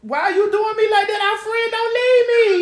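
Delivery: high, loud wailing plea; hammed-up begging not to be left; whiny mock wail to a leaving friend; mock pleading cry.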